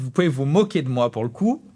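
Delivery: normal talking voice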